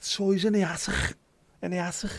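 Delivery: high-pitched, thick Scouse impression. Riffing on rock titles as a Scouser, hammed-up Scouse impression. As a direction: put on an exaggerated high Scouse accent. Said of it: "[name]'s doing a Scouse impression".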